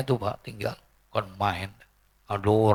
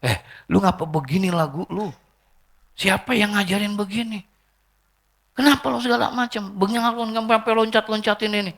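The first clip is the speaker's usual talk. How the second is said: higher-pitched exasperated questioning; annoyed scolding; 9 semitones higher than usual. Retold or imitated. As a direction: re-enact an irritated kiai telling someone off. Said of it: "Akhirnya saya kesel". (omelan kesal dengan nada naik)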